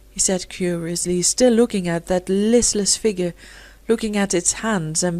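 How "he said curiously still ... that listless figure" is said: ordinary delivery, nothing marked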